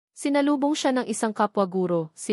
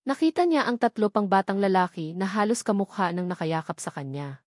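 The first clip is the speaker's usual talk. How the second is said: usual speaking voice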